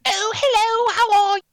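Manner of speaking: very high, hammed-up royal British voice; Queen. Showing a failed British accent, high mock-royal greeting. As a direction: squeal a posh greeting in falsetto. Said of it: "doing the impersonation of the Queen".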